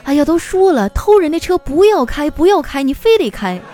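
hurried nagging wife. Acted. Wife hastily blaming husband before traffic police, nagging. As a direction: scold him in a wife's high voice. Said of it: "然后他媳妇儿赶紧说".

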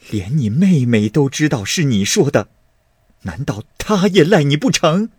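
exasperated accusing. A narrator's reading. Mother citing his sister against him, exasperated. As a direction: press the accusation home with mounting heat.

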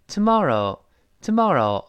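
American "tomorrow" with open ah, tuh-MAH-roh; easy American "tomorrow", o blended; American pronunciation. Shown as a demonstration. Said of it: "美国人都成".

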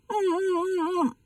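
high, soft mock sobbing; tearful whimper. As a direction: whimper pitifully like a wronged monk. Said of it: "一抹眼泪儿". (委屈地假哭抽泣)

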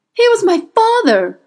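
high, loud cry of grief; anguished cry. A narrator's reading. Will revealing his father to the witch, anguished cry. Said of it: "he cried".

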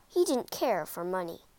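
ordinary delivery

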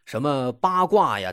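usual speaking voice

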